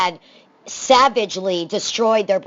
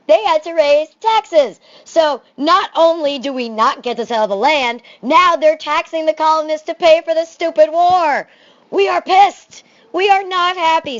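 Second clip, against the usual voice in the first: much higher and louder, building indignation; mock outrage; 9 semitones higher, 6 dB louder than usual. Teacher to class, voicing the colonists' anger over taxes, mock furious. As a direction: rant like a fed-up colonist.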